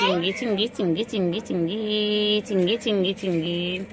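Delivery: loud, drawn-out calling of the pet's name. Real, not acted. Owner calling Chingri over and over, loudly.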